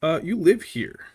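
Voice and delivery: incredulous and sarcastic; sarcastic disbelief. Retold or imitated. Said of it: "It's like".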